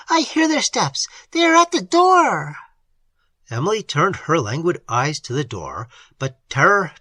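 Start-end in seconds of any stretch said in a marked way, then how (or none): 0.1-2.6 high, loud panic; terrified cry. A narrator's reading. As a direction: cry out as they approach. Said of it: "cried Madame Montoni".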